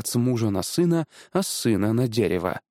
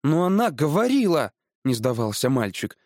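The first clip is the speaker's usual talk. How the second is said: put-on higher-pitched boy, not giving in; настойчивый мальчик; 3 semitones higher than usual. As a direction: plead your case like a stubborn boy. (упрямый мальчишеский голос)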